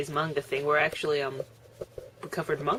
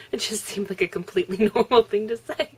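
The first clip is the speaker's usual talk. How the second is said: laughing; 5 semitones higher, 7 dB louder than usual. Real, not acted. Laughing at own story.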